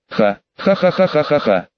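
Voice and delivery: acted crazed laugh in a changed voice; maniacal laugh. A narrator's reading. Wounded Tobi laughs at the clouded sky, maniacal laugh. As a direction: laugh like a madman, a string of ha-has. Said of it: "маниакально засмеялся он".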